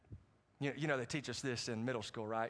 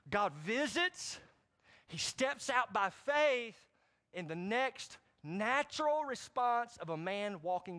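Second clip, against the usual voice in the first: slow preaching cry; 7 semitones higher, slower than usual. Real, not acted. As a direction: hammer the points at the congregation. Preacher driving home points.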